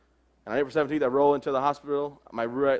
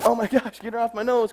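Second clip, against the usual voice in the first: mock panicked yelp; 9 semitones higher, 4 dB louder than usual. Baby grabbing his nose.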